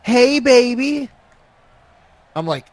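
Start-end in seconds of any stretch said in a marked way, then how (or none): 0.0-1.1 high, drawn-out come-on; slow, lifted catcall; catcall from a car. Retold or imitated. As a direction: stretch it out slowly, pitched up like a flirt. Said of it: "from the car, I hear".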